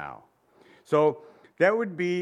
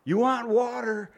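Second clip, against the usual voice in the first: higher, called-out question; shouted offer; 7 semitones higher than usual. Acted. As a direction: throw it out to the crowd. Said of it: "Jesus shouts this out".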